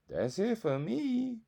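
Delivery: drawing a hard line, loud and slow; fed up. Real, not acted. Viewer done with a friend who ghosted, slowly raising the voice.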